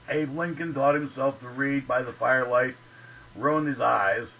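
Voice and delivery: ordinary talk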